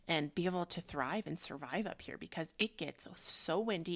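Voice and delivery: ordinary talk, nothing marked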